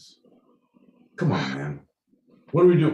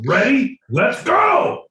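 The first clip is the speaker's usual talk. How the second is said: much higher, loud excited shout; hyped shout; 13 semitones higher, 6 dB louder, slower than usual. Real, not acted. Psyching up for the accent round, a loud hyped shout. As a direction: pump yourself up loudly.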